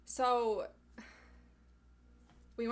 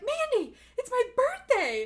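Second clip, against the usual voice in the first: excited squeal; 13 dB louder than usual. Retold or imitated. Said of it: "You're like".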